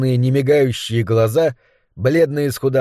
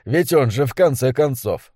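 ordinary delivery

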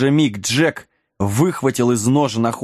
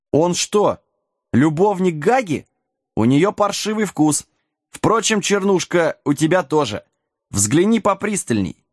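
high-pitched, brash needling; cheeky taunting; 6 semitones higher than usual. A narrator's reading. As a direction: needle them in a raised pitch. Wilkins insults Gaga and the god. (дерзкая насмешка повышенным голосом)

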